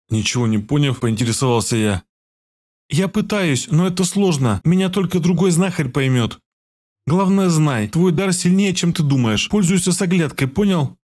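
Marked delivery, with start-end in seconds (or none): 2.9-11.0 put-on higher girl's voice pressing the point; urgent healer girl. A narrator's reading. Healer girl insists he use his gift carefully, pressing. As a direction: make him promise to be careful. Zakharia urges caution.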